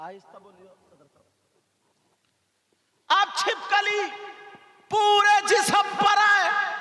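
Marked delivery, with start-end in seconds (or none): 3.1-6.7 high, loud preaching at full force; fiery sermon. Acted. Preacher warning the girls in full voice. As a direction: drive the tale home like a preacher.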